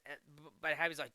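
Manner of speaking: ordinary talk, nothing marked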